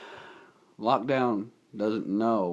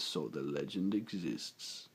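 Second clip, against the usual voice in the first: much lower, quiet, slow rumbling voice; deep dinosaur robot; 5 semitones lower, 9 dB quieter, slower than usual. Retold or imitated. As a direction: rumble it like an ancient beast. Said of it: "And Grimlock says".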